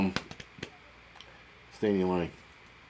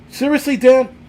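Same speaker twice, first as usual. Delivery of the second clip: shrill high disbelief at a viewer's guess; incredulous; 13 semitones higher, 8 dB louder than usual. Real, not acted. Reading a viewer's wrong guess, incredulous. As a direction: pitch jumps up in real disbelief. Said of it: "what the fuck is a".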